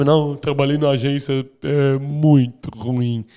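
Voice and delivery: slow, whiny complaining voice; whiny victim. Retold or imitated. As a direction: drag it out like a self-pitying victim. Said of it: "retira vitimismo".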